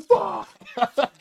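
loud, high shouted curse. Retold or imitated. Hearing the cops are here, loud high curse. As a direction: blurt it out in panic. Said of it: "I'm like".

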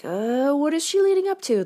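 high, doubtful imitation of a listener; skeptical listener voice. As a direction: squeak it out like a suspicious listener.

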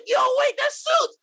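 very high shout of recognition; excited shout. Retold or imitated. Moviegoer recognising the suit, very high shout. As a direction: yell it up high, thrilled. Audience recognising Andrew Garfield.

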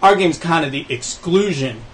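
slow, smug mock corporate tone; smug developer voice. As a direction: drawl it out like a smug executive. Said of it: "he's still gonna charge sixty and say".

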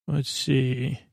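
usual speaking voice

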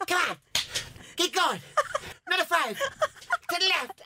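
lightly hammed-up class instructor calling out. Quoting the coworker to the class, mock instructor call. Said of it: "And he said".

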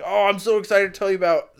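high, loud, gushing mock eagerness; retold excitement. Husband eagerly telling wife his news, in acted excitement.

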